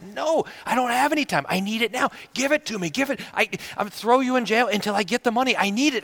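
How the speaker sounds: high, pushy, relentless; harsh refusal. Acted. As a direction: refuse him, greedy and hard. Refuses mercy to debtor.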